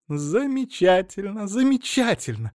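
very high, drawn-out glee; delighted. A narrator's reading. Wife clapping at husband's offer, delighted. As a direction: squeal it with delight. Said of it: "захлопала в ладоши Эльза".

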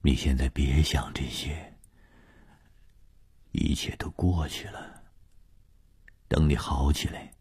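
hushed, near-whispered reassurance; very soft, with long gentle pauses; soft soothing. Acted. 红鱼 soothing the victim in bed, hushed and tender.

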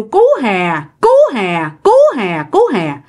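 voicing an eerie bird's repeated call; the same short call, over and over; lightly imitated bird cry on a loop. A narrator's reading. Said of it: "tục kêu".

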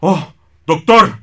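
a loud, high cry of alarm; shocked outburst. Acted. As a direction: burst out at the sight. Assistant discovers the paintings changed.

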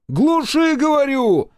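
high, loud shout; insistent high-pitched shouted command. A narrator's reading. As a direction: yell the order over the engine. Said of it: "громче повторил я".

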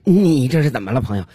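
a touch of nervous questioning; anxiously seek reassurance, pitch creeping up; mildly worried passenger; uneasy. Acted. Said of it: "心里有点发毛了。 他就赶紧问".

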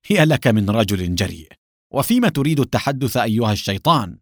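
usual speaking voice

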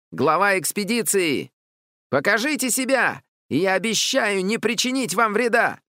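high, declaiming call; bold child commander. A narrator's reading. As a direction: announce yourself grandly to an unseen foe. Boy commander calls to hidden foe. (звонкий командирский выкрик)

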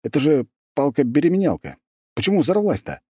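high, incredulous question; baffled. Acted. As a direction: sound truly baffled. Hero baffled by explosion.

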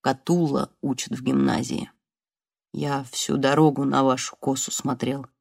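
lightly voiced exhausted man; weak wounded man. Weak wounded man talks softly. (тихо, низко и устало)

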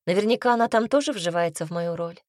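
ordinary delivery, nothing marked

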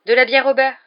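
loud, high-pitched burst of enthusiasm; spontaneous exclamation. A narrator's reading. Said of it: "s'exclama Harry sans y penser". (exclamation spontanée, forte et aiguë)

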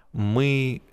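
plain reading voice

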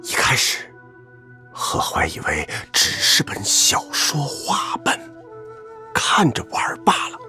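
deliberately hushed, secretive telling. Acted. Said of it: "你不要刻意压低声音".

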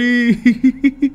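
high evil chuckle; gleeful laugh. Acted. Servant Tuất laughing while telling the story. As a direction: laugh with cruel delight. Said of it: "sung sướng mà bảo".

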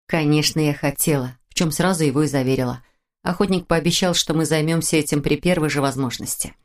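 ordinary delivery, nothing marked

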